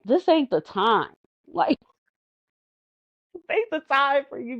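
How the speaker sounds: stern scolding. Retold or imitated. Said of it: "sometimes they stress me out".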